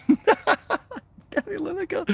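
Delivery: high slow falsetto mimicry of "Gary Lineker"; drawn-out squeaky mockery; parroting the commenter in a silly voice; mocking squeaky voice.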